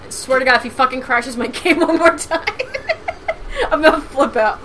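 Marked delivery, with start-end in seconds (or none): 2.5-3.6 high laugh. Real, not acted. Laughing at crash.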